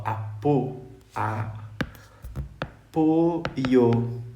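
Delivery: apoio drawn out with a closed ô; slow closed-vowel demo. Shown as a demonstration. Teacher contrasting the noun apoio, slowly. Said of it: "agora é substantivo".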